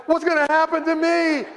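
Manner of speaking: high panicked whine; mock panic. Boy fearing Dad's belt, high panicked whine. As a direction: wail it like a kid dreading his turn. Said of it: "I'm freaking out".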